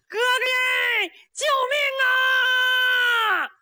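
long, drawn-out high cry for help; calling for help. A narrator's reading. Captured Niu Tong hollering for his brother to save him, drawn-out and high. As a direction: yell it slow so it carries through the woods. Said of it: "他喊上了。".

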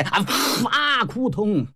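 puffed burst, cry and thud; imitated hit and fall. Storyteller imitates the third brother falling, burst and thud. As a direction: act out a short hit and collapse.

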